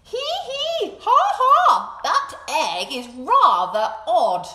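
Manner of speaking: high, loud cackling jeer; mocking birds. Other birds laugh at Duck's egg, high mocking cackle. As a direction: sneer at the odd egg. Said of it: "they said".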